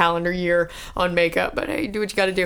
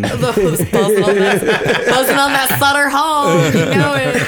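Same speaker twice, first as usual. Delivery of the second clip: laughing and slowing down louder; 6 dB louder, slower than usual. Real, not acted. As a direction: laugh through the words. Laughing along with the tease.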